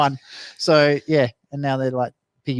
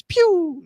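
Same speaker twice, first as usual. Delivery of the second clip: high squeaky pew sound; firework pew. Retold or imitated. Imitating firework noise.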